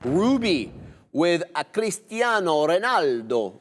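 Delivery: put-on slow, drawn-out Portuguese-flavoured accent; playful Cristiano Ronaldo accent imitation. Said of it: "I don't know why I did that accent".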